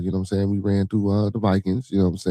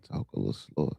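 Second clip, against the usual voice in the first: quietly read comment; 8 semitones lower, 11 dB quieter than usual. Retold or imitated. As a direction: read the comment softer, almost under the breath. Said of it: "You say".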